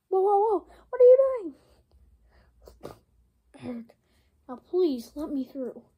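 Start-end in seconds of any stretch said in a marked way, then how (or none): 0.1-1.5 high, loud startled yelling; shouting at Donkey Kong; loud alarmed shout. Acted. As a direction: cry out in alarm.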